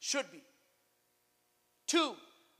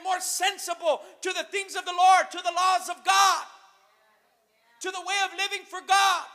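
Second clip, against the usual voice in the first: high, raised pulpit shout; impassioned exhortation; 4 semitones higher, 4 dB louder than usual. Real, not acted. Preacher urging the church to live for God, impassioned. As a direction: ring out each phrase, peaking on "God".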